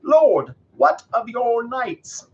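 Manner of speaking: high, loud, drawn-out appeal; as loud as they dare. A narrator's reading. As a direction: call out to the king. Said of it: "as loudly and often as they dared".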